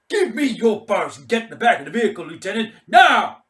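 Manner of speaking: loud, high, fast command; barked order. Acted. Mad Mike ordering the lieutenant into the vehicle, barked command. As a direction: hammer the order home on now.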